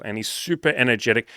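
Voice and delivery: ordinary talk, nothing marked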